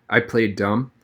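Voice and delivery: usual speaking voice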